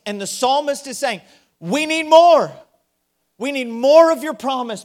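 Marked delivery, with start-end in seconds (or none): 1.6-2.5 high, loud cry of need; pleading cry. Acted. Preacher voicing the psalmist's plea, loud and high. 3.4-4.8 pleading. Acted. Psalmist's plea continues.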